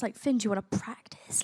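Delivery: whispering. Retold or imitated. Nervous quoted aside.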